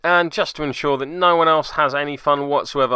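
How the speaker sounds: normal talking voice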